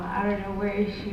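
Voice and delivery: normal talking voice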